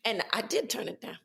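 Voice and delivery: normal talking voice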